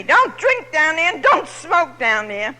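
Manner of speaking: retold scream. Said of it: "they're screaming at me".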